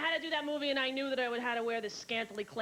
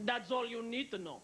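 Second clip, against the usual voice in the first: cool Iman; 2 semitones lower than usual. Retold or imitated. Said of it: "she turns and says".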